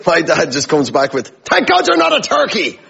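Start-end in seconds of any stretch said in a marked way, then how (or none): 1.5-2.8 very high, piping mock retort; little dad's comeback. Dad to the big American, high-pitched imitated retort. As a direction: shoot up high as the tiny Irish father.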